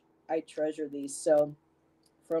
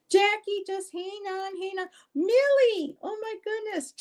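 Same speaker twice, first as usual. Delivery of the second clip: much higher, coaxing voice to the pets. Real, not acted. Owner coaxing pets during filming, high sing-song voice. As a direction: sweet-talk the animals to settle down. Talking to pets mid-video.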